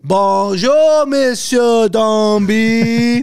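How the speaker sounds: exaggerated sing-song schoolroom French, stretching every syllable; mock classroom greeting. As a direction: chant it like a class greeting their French teacher. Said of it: "we used to have to say".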